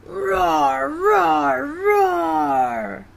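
big storybook roaring; lion roar. Acted. Little lion finally roars.

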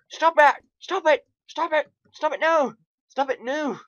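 high, loud repeated protests; panicked yelling. Real, not acted. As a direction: squeal frantic orders at them. Yells at exploding game mobs.

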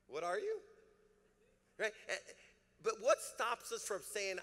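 ordinary delivery

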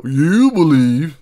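loud, high, drawn-out 'you believe'; hammed-up comic caricature; silly mock voice. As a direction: stretch it into a goofy accusation. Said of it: "I'm being silly".

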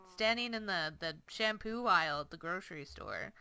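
ordinary talk, nothing marked

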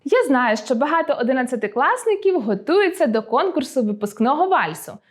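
normal talking voice

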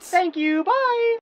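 very high singsong goodbye; chirpy sign-off. Acted. Sonic hanging up on Shadow, chirpy. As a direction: breezily cut the call short.

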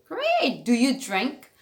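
usual speaking voice

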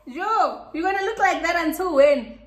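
high, loud teasing through a laugh; mock your friend while cracking up; laughing tease. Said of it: "Bully keeps being like".

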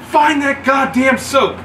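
high, slow, loud barked order; drill instructor yell. Retold or imitated. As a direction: bark it like an angry sergeant. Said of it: "he's yelling at us".

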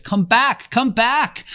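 desperate high scream. A narrator's reading. Boy screaming at a departing ship, desperate. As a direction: scream after the ship as it leaves. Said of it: "and screamed at the ship".

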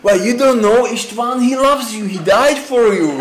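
high, earnest mock preaching; eager missionary. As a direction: insist brightly, like a street evangelist. Missionary insisting to puzzled passerby.

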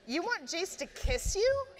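high, bewildered disbelief. A narrator's reading. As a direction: ask it baffled, voice jumping up. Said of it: "Clary said, bewildered".

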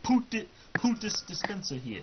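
high squeaky imitation; imitating a voice. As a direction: squeak it like a silly character. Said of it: "that's how he says it".

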